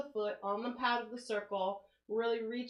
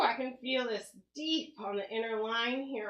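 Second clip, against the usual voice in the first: normal talking voice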